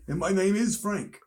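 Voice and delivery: high put-on voice; mock character. Friend joining the impression as Frank, high caricature. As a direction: introduce yourself in a high silly voice.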